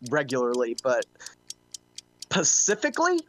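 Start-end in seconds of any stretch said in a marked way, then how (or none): 2.2-3.2 "specifically" with the S dropped, pitched high in disbelief; high, questioning "specifically" without its first S; mispronounced "pacifically". Retold or imitated. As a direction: say "specifically" as pa-CIF-ically, incredulous. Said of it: "if you're going pacifically".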